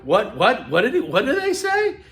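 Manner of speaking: confused sudden high what; startled listener. Retold or imitated. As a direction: snap to attention, puzzled. Distracted listener suddenly tuning in.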